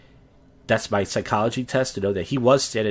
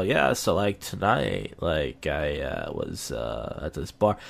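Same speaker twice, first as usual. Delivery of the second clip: demonstrating a lowered, too-cool voice; talking low, cool; 7 semitones lower, 3 dB quieter, slower than usual. Shown as a demonstration. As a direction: voice sinking low and soft. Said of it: "but I'll talk like this".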